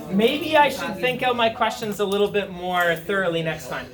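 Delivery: normal talking voice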